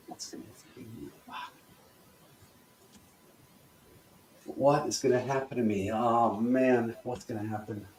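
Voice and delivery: subdued, sinking dread; worrying. Shown as a demonstration. Coach models worry for the class, quietly anxious. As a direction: fret under your breath, fading out. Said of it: "really get that worry worked up".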